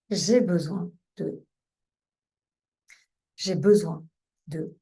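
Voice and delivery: low, measured mantra with long pauses; calm guided tapping phrase, unhurried; calming repetition. Shown as a demonstration. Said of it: "Vous voyez que ça calme déjà".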